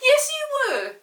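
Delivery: very high, loud insisting; insistent girl. Acted. Molly insists to Peter, high and loud. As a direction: insist like a bossy sister. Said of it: "said Molly".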